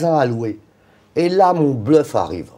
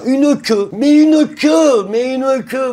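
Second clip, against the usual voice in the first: a huge queue and he can't believe it; amazed, building; 10 semitones higher, 5 dB louder, much slower than usual. Real, not acted. The founder to the audience, amazed, loud and slow. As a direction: stretch out une queue, much higher and louder.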